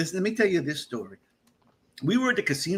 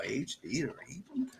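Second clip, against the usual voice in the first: whispered; 2 semitones lower, 14 dB quieter than usual. Shown as a demonstration. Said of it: "repeat of the name in whisper".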